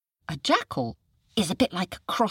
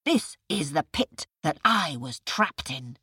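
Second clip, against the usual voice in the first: irritated tiger. Acted. Tiger explains, angry.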